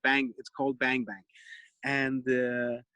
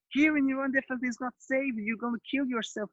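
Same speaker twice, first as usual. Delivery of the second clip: worried mother; 8 semitones higher than usual. Retold or imitated. Mother warning it's unsafe.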